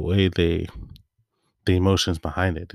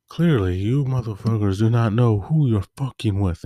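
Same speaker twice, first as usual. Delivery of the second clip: higher, deliberate action-hero taunt; Blade; 4 semitones higher than usual. Retold or imitated. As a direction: deliver a defiant warning to surrounding enemies. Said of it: "and he goes".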